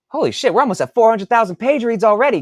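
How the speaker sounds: high, loud and fast with delight; excited outburst. Real, not acted. Author thrilled by his page-read total, excited outburst. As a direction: blurt out the good news.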